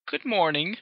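high, polite, refined greeting. A narrator's reading. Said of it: "Rarity said a bit more politely".

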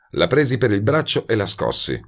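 plain reading voice

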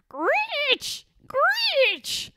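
very high screeching birds; shrill bird-monster cry; snarling bird screech. A narrator's reading. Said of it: "snarled the nizzards".

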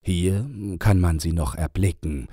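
ordinary delivery

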